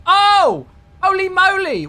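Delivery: high, loud burst of real surprise; surprised shout. Real, not acted. Streamer shocked by a surprise gift, loud surprised yelp. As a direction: jump out of your seat at the surprise. Sudden donation during stream.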